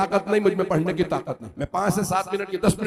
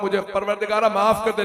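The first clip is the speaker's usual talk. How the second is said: ordinary talk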